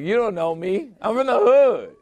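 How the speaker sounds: high, loud put-on street tough; mock tough guy. Joking threat to the old man, mock tough voice.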